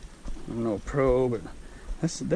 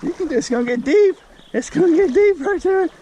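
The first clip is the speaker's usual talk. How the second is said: doggy voice; 12 semitones higher, 3 dB louder than usual. Real, not acted. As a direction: coo up high like talking to a puppy. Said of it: "in doggy voice".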